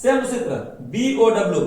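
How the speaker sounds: normal talking voice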